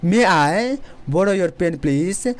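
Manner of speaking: very slow, careful words; slow classroom demo; slow teaching delivery. Shown as a demonstration. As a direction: model it slowly. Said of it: "इस type से बोल सकते हैं".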